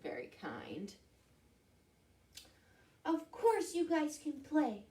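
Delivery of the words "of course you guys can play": high little mouse; high-pitched friendly mouse character. Acted. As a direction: pipe up as a small mouse. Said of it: "Mouse said".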